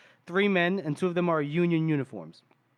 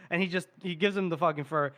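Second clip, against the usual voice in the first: ordinary delivery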